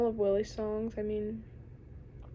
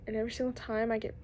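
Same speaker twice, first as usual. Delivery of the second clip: ordinary talk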